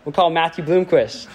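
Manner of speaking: usual speaking voice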